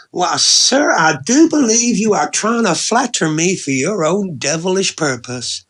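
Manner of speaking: high, loud, hammed-up Southern belle; southern belle accent. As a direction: play the coy Southern belle, mock-affronted. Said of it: "Again, that southern belle accent".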